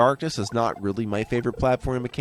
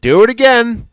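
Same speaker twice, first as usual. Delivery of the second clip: high loud call to retry; loud self-command; 13 semitones higher, 12 dB louder, slower than usual. Real, not acted. Player yells at self to retry the level. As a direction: bark it at yourself. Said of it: "commands self to retry".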